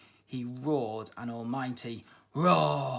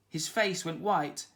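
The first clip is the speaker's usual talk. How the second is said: ordinary delivery, nothing marked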